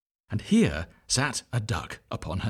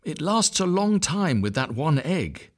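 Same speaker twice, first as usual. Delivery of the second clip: higher, slow tired complaint; weary mother duck; 7 semitones higher, slower than usual. A narrator's reading. Mother duck complaining to the visitor, slow and high. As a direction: moan it like a worn-out mother. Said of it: "said the duck who sat there".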